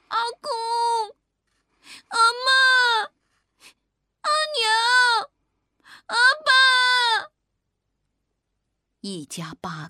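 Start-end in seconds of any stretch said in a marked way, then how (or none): 0.1-7.3 put-on loud, high-pitched child's cry; shouting to the sea. A narrator's reading. Child calling lost family across the sea, desperate drawn-out shout. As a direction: call out at full voice, each word long drawn out. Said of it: "冲着大海大喊".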